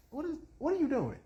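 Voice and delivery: high, quick aside to a dog; scolding the dog. Real, not acted. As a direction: break off and scold a misbehaving dog.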